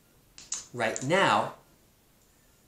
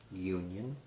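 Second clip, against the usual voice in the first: soft repeats; 3 semitones lower, 9 dB quieter than usual. Shown as a demonstration. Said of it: "that has two syllables".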